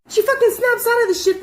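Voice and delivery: ordinary delivery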